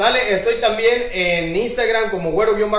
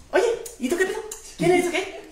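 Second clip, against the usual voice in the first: much higher, squeaky mock voice; animal in the joke; 10 semitones higher, 6 dB quieter, faster than usual. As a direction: ask it like a nosy little animal. (voz chillona de animal preguntón)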